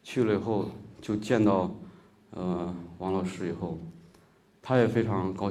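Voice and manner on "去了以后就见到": ordinary delivery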